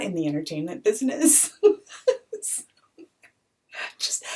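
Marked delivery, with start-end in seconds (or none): 1.6-2.6 laugh near tears. Real, not acted. Laugh mixed with crying.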